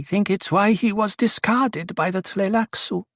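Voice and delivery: high voice with mild worry; worried. A narrator's reading. Duri explains why the dwarf was discarded, worried. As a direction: explain uneasily, pitched well up. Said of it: "worry in her voice".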